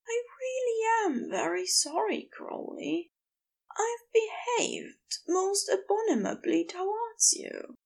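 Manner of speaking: high, contrite character voice; high-pitched, formal apology; Aziraphale, deeply sorry. Acted. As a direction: plead for pardon in a high, delicate register.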